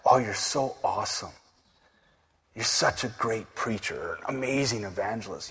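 softly cooing fake praise; a lightly mocking flatterer's compliments; mock flattery. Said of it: "don't ever get tantalized by".

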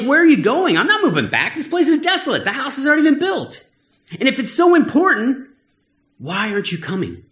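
a follower objecting to the leader in high-pitched disbelief; incredulous protest. Acted. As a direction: plead in disbelief that the leader stays behind.

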